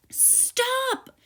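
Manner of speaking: sharp high loud cry; startled high-pitched shout of stop. A narrator's reading. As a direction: yell it out suddenly. Moon skids, exclamation.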